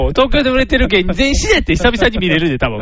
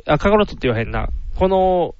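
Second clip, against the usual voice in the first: usual speaking voice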